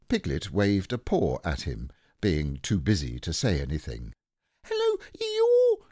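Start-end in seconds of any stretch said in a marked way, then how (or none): 4.6-5.9 very high, drawn-out squeaky greeting; tiny excited creature piping up; Roo squeaking. A narrator's reading. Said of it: "said Roo".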